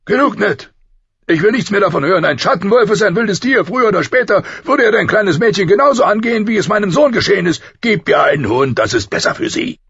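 loud, high, fast angry tirade easing at the end; irritated royal outburst. A narrator's reading. King overruling Ned about the direwolf, angrily. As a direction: shut the debate down at full voice. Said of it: "war nicht in der Stimmung für weitere Debatten".

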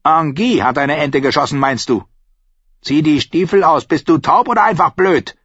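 high, scolding landlady voice; scornful innkeeper. Acted. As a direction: echo with scorn, then scold.

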